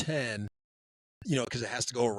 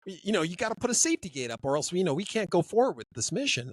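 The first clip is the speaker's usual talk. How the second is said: higher-pitched mock insistence; safety guy; 7 semitones higher, 3 dB louder than usual. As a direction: lightly play an insistent safety officer. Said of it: "the safety guys".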